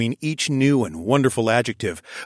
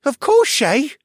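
put-on high, louder eager boy's reply; boy thrilled with a job; excited young boy; 7 dB louder than usual. A narrator's reading. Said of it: "running eagerly out of the room".

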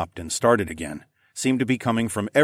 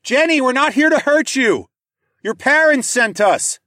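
much higher, loud reassuring call; 8 dB louder than usual. A narrator's reading. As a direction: shout to her that she's safe, loud. Calling to hidden girl.

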